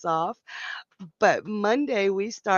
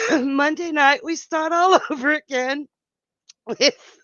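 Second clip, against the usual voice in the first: laughing; 6 semitones higher, 5 dB louder than usual. Real, not acted. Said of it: "I may stop laughing".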